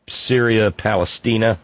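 ordinary talk, nothing marked

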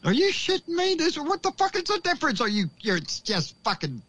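very high-pitched mock disbelief, easing down; incredulous reaction. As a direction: act out squeaky, baffled outrage. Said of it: "I kept looking at this going".

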